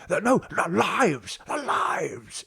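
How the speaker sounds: high, drawn-out mock protest; Picard. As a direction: draw the words out in an exaggerated impression. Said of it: "Picard's like".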